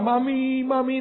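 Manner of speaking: high mock whimper of a homesick wife; crying for mommy. Preacher mimicking his wife homesick, high mock whining. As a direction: whine like a child calling mami. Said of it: "dia nangis terus".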